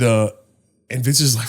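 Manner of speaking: usual speaking voice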